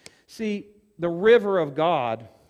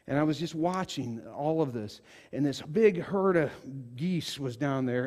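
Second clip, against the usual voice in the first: ordinary delivery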